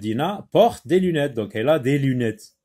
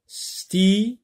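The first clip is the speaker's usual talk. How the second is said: "Stylo" higher, first syllable held, then lo; split into two syllables; 5 semitones higher than usual. Shown as a demonstration. Teacher showing two syllables to pupils, split into syllables. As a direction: stretch sty and pause before lo in "Stylo". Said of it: "deux syllabes".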